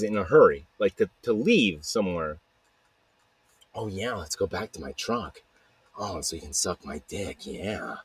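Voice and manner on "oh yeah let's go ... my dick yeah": low, hushed, sleazy imitation of a guy; a quiet, oily mock come-on; sleazy mock invitation. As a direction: drop the voice and mock a creep's come-on. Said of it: "He was probably like".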